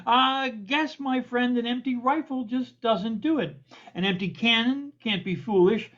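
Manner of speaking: lilting rhymed verse read to an audience; comic poem recitation. Acted. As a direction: recite light satirical verse, leaning on the rhymes. Recites their own comic poem.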